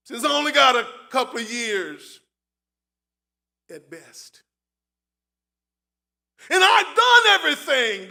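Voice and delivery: preached at full force as grieving Job; heavy preaching lament. Acted. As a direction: cry out the loss like a ruined man before the church.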